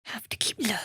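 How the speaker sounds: soft whisper while sneaking; stealthy whisper. Acted. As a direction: keep it hushed so no one hears.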